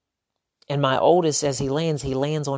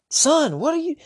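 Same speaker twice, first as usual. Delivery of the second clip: much higher, louder sharp snap; snapping at son; 14 semitones higher, 3 dB louder than usual. Retold or imitated. Dad snapping at his oldest son, high and sharp. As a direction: bite at the kid in alarm. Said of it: "probably snapped again".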